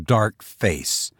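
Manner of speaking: usual speaking voice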